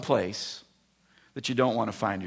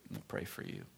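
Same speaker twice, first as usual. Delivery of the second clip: softly crying elder; 16 dB quieter than usual. Retold or imitated. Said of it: "started crying".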